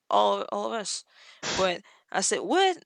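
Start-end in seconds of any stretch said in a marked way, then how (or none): 2.4-2.8 a sharp, high what; quick disbelieving retort; incredulous what. Retold or imitated. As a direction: react in disbelief. Retold incredulous reaction.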